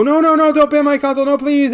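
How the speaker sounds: put-on high, loud, whiny child begging; pleading kid. Kid pleading with the troll, high panicked whine. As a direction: beg like a panicked little kid about to lose his console. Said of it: "And the kid would go".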